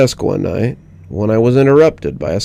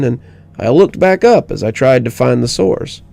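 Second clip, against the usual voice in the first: ordinary delivery, nothing marked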